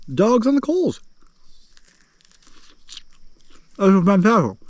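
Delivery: high, loud goofy delight; silly high voice. Pretend. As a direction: squeal it like a goofy chef.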